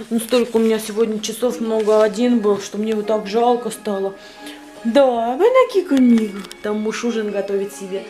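usual speaking voice

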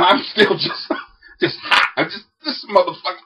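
ordinary talk, nothing marked

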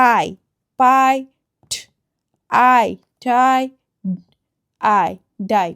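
পাই, টাই, ডাই sounded out with pauses; long I. Shown as a demonstration. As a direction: isolate "eye", then blend পাই, টাই, ডাই. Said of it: "আই এর long sound করে". (পাই, টাই, ডাই-এ আলাদা করে আই)

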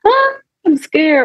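high, slow mock panic; hammed-up frightened cry; mock scared. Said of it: "It's not this, like".

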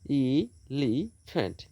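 "Elephant" slowly as এ, লি, ফ্যান্ট; three separate beats in "elephant"; syllable by syllable. Shown as a demonstration. Teacher counting syllables, "elephant" slowly in three beats. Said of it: "তিনটি syllable".

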